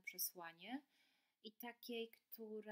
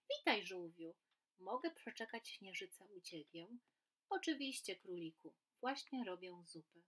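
lively storybook reading with voiced animals; reading to children; 6 dB louder than usual. A narrator's reading. Teacher reading a story aloud to children, lively and animated. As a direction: read it like a bedtime tale for kids.

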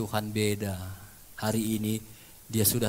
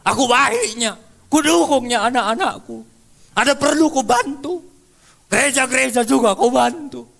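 angry rant; 11 semitones higher, 9 dB louder than usual. Retold or imitated. Sick man rants at friends.

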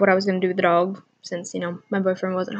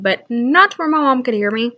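ordinary talk

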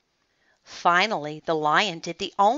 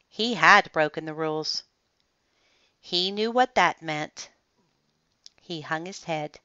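usual speaking voice

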